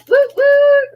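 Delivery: high, loud celebratory woo; excited cheer. Real, not acted. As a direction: cheer the winner on. Cheering a quiz winner.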